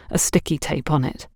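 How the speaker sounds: ordinary delivery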